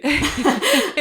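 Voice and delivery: short, bright, high giggle; high laugh. Real, not acted. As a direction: burst into a light laugh. Laughs after noticing her smile.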